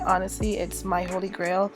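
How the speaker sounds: ordinary talk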